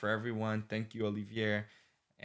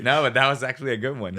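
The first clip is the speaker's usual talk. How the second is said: fast and louder, laughing as he speaks; 2 semitones higher, 10 dB louder, much faster than usual. Real, not acted. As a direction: chuckle while praising the pun. Praising the joke, laughing.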